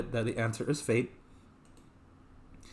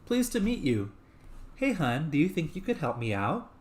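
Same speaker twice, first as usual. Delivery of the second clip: higher-pitched put-on woman; female voice; 6 semitones higher than usual. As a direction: lift the voice into a woman's register. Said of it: "then you have the female voice".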